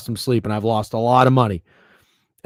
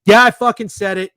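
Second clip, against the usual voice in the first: high, loud defiant boast; cocky shouted yeah; defiant outburst; 10 semitones higher, 5 dB louder than usual. Real, not acted. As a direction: own the hot take out loud. Defiant hot take.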